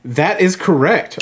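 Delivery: slow game-show host reveal. Acted. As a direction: reveal it like a quiz host milking the moment. Game host reveals the right answer.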